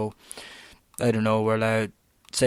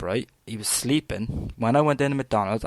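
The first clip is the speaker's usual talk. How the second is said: ordinary talk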